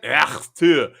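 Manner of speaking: "Ach, tu" hawked from the throat in a mock Russian accent; a throat-clearing Russian accent jab. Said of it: "Would you like my Russian accent?".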